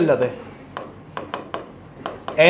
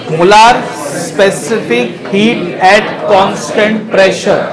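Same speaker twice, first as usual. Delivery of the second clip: very slow, emphatic term by term; slow dictation; 2 semitones higher, 3 dB louder, much slower than usual. Real, not acted. Teacher giving the class a definition, slow and emphatic. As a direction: spell the definition out slowly, word by word.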